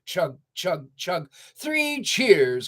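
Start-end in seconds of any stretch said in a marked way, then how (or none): 1.5-2.7 high, excited cheer; cheering cry. A narrator's reading. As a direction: cheer for the old car. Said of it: "cried the pickup truck".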